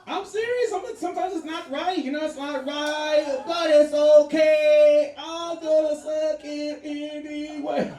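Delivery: high, slow drawn-out falsetto, loud on but it's okay; confessing his tastes in a high camp voice; mock falsetto confession.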